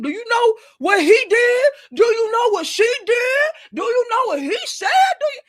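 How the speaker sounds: high, loud whiny mock of her, rising at the ends; mocking the victim. Vlogger mimicking the victim's indignant questions, high and shouty. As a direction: squeal it like an outraged complainer.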